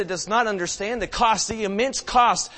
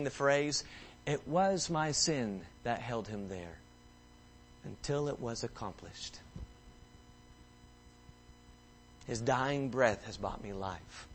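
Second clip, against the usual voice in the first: much softer and lower, hushed; soft, low preaching; 6 semitones lower, 8 dB quieter than usual. Real, not acted. Preacher quietly telling the family dinner story, hushed. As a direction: draw them in close and tender.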